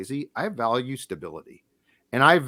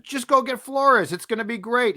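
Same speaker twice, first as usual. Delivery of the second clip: higher-pitched mock enthusiasm; glib fan take; 9 semitones higher than usual. Host mimicking fans' simple take on the decision, glib and high. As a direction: toss it off as an eager fan's easy answer.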